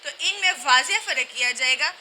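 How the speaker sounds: normal talking voice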